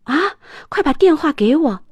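snatch at the phone in shock; startled mother. Acted. Mom, shocked by the news, demanding the phone. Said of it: "妈妈大吃一惊".

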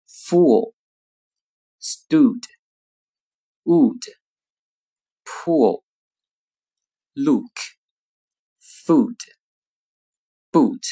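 each word with a long tense oo; long vowel words. Shown as a demonstration. Teacher reading long-vowel words. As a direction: stretch the oo in every word. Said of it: "先看长音的单词".

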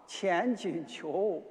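ordinary delivery

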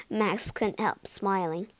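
ordinary delivery, nothing marked

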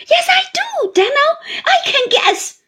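very high, loud tearful insistence; crying out. A narrator's reading. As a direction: cry out that she knows the hardship. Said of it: "cried Missus Gummidge".

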